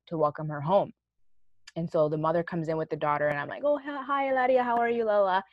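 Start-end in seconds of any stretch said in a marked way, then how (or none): 3.6-5.4 put-on higher, sing-song hello to a child; sweet excited greeting. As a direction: gush over the kid coming home.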